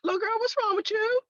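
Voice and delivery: put-on high, alarmed question to a girl; startled mentor. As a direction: mentor hearing her scream, taken aback. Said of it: "she was like".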